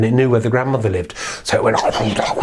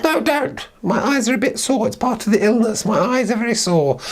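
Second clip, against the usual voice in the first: wolf as grandmother; 12 semitones higher than usual. Acted. The wolf in grandmother's bed calls her in, very high. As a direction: lure her in sweetly.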